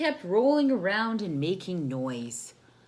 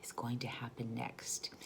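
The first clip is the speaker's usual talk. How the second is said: whisper; 7 semitones lower, 10 dB quieter than usual. Acted. Whispered aside to children.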